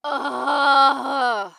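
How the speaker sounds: loud, fed-up groan; reluctant ugh of defeat; exasperated groan. Acted. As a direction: groan like you've lost the argument. Reluctantly agreeing to use bow.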